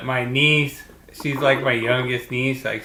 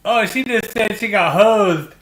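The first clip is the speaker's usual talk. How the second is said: amused retelling; 8 semitones higher, 4 dB louder than usual. Retold or imitated. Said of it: "I was like".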